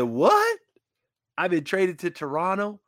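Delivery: a high, jolted "What?" then stunned; shocked disbelief. Retold or imitated. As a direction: caught completely off guard. Hearing of his trade.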